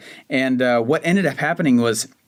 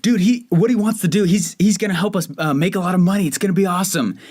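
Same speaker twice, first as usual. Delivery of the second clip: hyped buddy; 5 semitones higher than usual. Retold or imitated. Buddy calling Steve about a money guy, higher hyped-up pitch. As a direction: sell the big chance to your friend. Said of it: "he goes".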